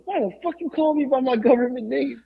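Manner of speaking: high caricature grumble; mock New Jack. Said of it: "now New Jack goes".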